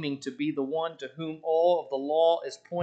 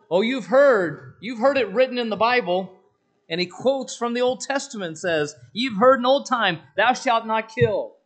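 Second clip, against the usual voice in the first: high, loud preaching; bold proclaiming; 6 semitones higher, 6 dB louder than usual. Acted. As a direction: ring it out with authority. Preacher voicing Jesus' bold words.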